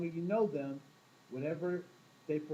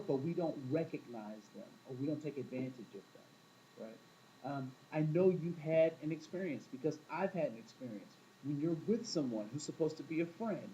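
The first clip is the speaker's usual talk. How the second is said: normal talking voice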